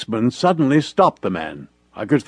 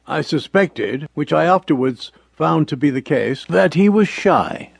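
plain reading voice

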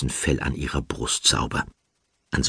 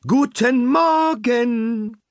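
much higher, slow, sing-song greeting; cheerful fluting; 4 dB louder, much slower than usual. Acted. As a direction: flute it out brightly to wake everyone. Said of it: "fröhlich flötende Stimme".

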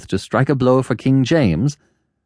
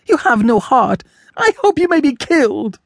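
very high, impassioned outburst; crying out; 13 semitones higher than usual. A narrator's reading. Woman cursing her lover, crying out very high. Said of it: "she cried".

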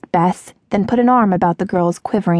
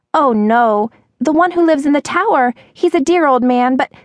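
put-on high girlish voice, quick in places; high-pitched earnest waitress; anxious waitress; 8 semitones higher than usual. A narrator's reading. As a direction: explain in a high voice, then break off. Agitated waitress voiced in story.

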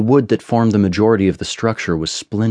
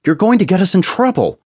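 high-pitched protesting outcry; crying out; 12 semitones higher, 4 dB louder than usual. A narrator's reading. Narrator warns friend breaking through the gate, crying out. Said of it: "I cried out".

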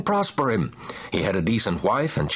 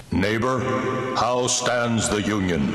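slow, lightly deepened ghostly voice; a slow ghost speaking from the grave; deep booming voice. A narrator's reading. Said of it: "you'll hear a deep voice saying".

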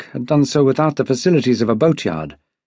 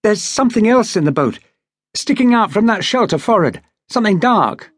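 higher, eager but reined in; pointing out a shape in the boat, barely containing excitement; held-back excitement; 7 semitones higher than usual. A narrator's reading. Young officer spotting something to the older men, held-back excitement. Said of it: "controlling his excitement with difficulty".

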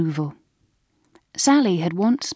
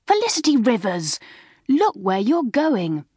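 higher, slowed-down scolding; wrathful; 5 semitones higher than usual. A narrator's reading. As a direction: tell off a clumsy junior indignantly. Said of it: "said a wrathful voice".

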